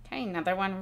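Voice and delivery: ordinary delivery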